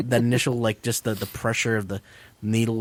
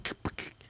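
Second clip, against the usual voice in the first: unvoiced imitated staple sounds; breathy mimicked clicks; whispered staple clicks; 15 dB quieter than usual. Miming stapling bills.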